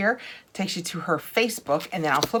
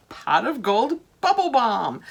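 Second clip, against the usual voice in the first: slow, higher, sing-song announcement; delighted name reveal; 5 semitones higher, 4 dB louder, slower than usual. Real, not acted. Vlogger shows viewers the new bath balm, delighted and slow.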